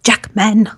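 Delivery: "Jackman" said as he pronounces his own name; "Jackman" lightly imitated as a self-introduction; imitated name pronunciation. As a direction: mimic his way of saying "Jackman". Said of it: "when he pronounced his own name".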